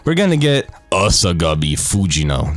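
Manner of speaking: "Asagami Fujino" said low and deliberately; low, careful "Asagami Fujino"; careful pronunciation. Shown as a demonstration. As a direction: show off how "Asagami Fujino" is pronounced. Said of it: "notice how I pronounced it".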